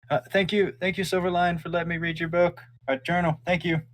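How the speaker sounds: a small dragon thanking politely; young dragon voice. Acted. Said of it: "and looks over and goes".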